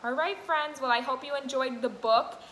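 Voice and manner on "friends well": ordinary talk, nothing marked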